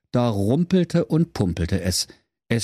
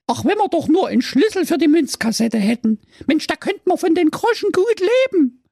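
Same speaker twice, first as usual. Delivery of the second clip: very high, louder gushing; delighted sister; 3 dB louder than usual. Acted. Sister thrilled by the phone box, very high excited voice. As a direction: gush about the new home.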